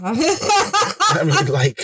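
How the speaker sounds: breaks into laughter; high, laughing burst. Real, not acted. Cracking up at own joke.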